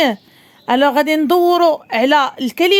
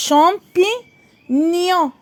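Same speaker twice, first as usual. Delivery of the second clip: "Champignon" slow, with a long pause before gnon; syllable by syllable; much slower than usual. Shown as a demonstration. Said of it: "À la fin".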